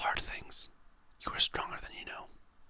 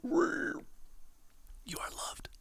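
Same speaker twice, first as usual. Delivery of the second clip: very slow whisper; much slower than usual. Shown as a demonstration. Whispering affirmations to the viewer, very slowly drawn out. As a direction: stretch "You are loved" out slowly, each word lingering.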